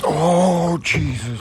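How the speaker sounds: loud, high burst of amazement; excited shout. Real, not acted. Angler amazed at his catch, loud high excited shout. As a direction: shout it as the huge bass comes up. Huge bass landed.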